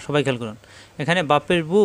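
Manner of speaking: usual speaking voice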